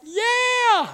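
high, playful exclaim; Jesus teasing frightened disciples; gleeful yeah. Retold or imitated. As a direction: cheer like a kid pulling a prank. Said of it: "Jesus is like".

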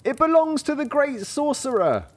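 very high, odd-sounding reply; high-pitched farmhands answering; villagers' voice. Acted. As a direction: squeak it out like frightened farmhands. Haymakers answer the cat.

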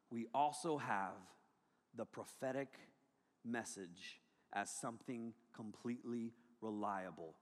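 hushed solemn reading; reverent reading. Acted. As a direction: read it like a sacred confession. Peter quoted softly.

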